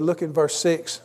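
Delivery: ordinary delivery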